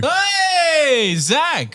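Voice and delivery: high, loud excited hello; excited greeting. Real, not acted. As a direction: call out the name with delight. Greeting regular storyteller excitedly.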